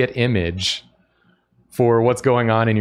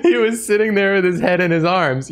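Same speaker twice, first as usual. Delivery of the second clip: laughing; 7 semitones higher than usual. Real, not acted. Cracking up reading.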